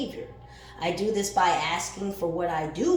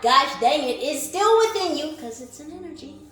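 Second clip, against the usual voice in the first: high and loud excited exclaim; 9 semitones higher, 7 dB louder than usual. Real, not acted. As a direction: throw it out with fired-up energy. Excited tarot reading.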